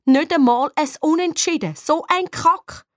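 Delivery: high, disgusted complaint slowing at the end; sore-loser outburst; bitter frustration. A narrator's reading. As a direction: curse a lost match. Bitter reaction to losing.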